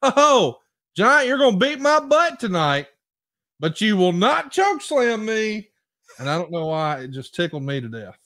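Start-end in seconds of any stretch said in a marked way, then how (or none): 0.0-5.7 high, loud trash-talk yell at the Giant; mock promo shout. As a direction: shout the taunt up high like a cocky heel. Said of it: "He says something like".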